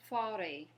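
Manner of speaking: forêt, final t silent; slow clear model of forêt; forêt, stress on the last syllable, t silent; French forêt. Shown as a demonstration. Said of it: "Go ahead and say that one".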